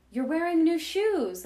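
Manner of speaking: guest voice. Retold or imitated. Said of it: "there's a guest who's like".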